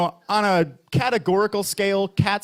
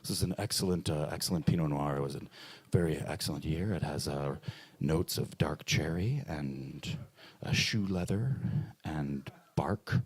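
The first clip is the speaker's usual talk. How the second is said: pretentious waiter; 10 dB quieter than usual. Retold or imitated. Comic imitating a snobby waiter describing Pinot Noir. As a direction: purr the tasting notes like a wine snob, fading to a whisper on bark. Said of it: "he wants to kinda talk about it".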